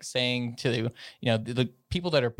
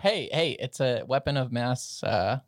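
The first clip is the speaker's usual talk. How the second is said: ordinary talk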